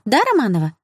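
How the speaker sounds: high, plaintive coaxing; whiny appeal for agreement; wheedling. A narrator's reading. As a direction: coax her to back up the excuse. Bully pleads for victim's agreement.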